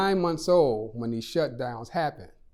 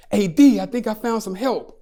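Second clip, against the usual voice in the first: higher and louder, excited phone greeting; real excited; 7 semitones higher, 9 dB louder than usual. Retold or imitated. Friend phones with news of found help, real excited. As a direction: burst out with thrilled relief, loud and high. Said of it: "real excited".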